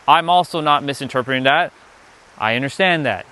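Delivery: ordinary delivery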